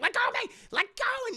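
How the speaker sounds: high desperate cries; hammed-up fight to break free; mock struggle. Brianna stabbing the villain, high mock panic.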